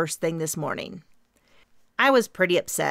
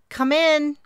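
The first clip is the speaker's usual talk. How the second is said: louder, higher call to the door; 10 semitones higher, 6 dB louder than usual. Acted. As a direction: call it out across the room. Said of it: "I called out".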